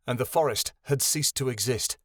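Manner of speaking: usual speaking voice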